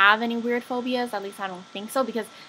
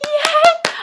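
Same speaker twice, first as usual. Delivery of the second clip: high, loud squealed yay; playful cheer; 7 dB louder than usual. Pretend. Mock cheer about spring break.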